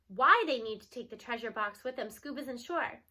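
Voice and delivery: plain reading voice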